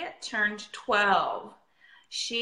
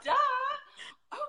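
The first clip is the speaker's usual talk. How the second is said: mock duh. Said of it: "it's like".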